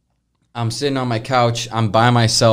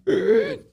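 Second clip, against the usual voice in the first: short high-pitched squeak for a door; imitated door noise. Retelling a door suddenly opening, high imitated noise. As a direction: make a high little door sound.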